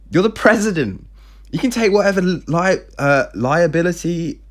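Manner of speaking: high-pitched, insistent protest; acted exasperated retort; indignant challenge. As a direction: throw it back at him, indignant. Daughter challenges her evasive father.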